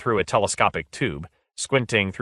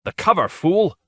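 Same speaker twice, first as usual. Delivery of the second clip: high, loud harsh snap at a servant; harsh angry cry; 10 semitones higher, 4 dB louder than usual. A narrator's reading. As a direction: bark it out in raw anger. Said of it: "in a voice harsh with anger".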